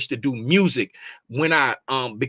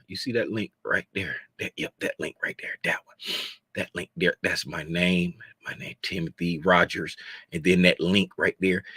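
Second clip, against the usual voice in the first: hushed aside; 10 semitones lower, 8 dB quieter than usual. Pretend. Secretive aside to viewers.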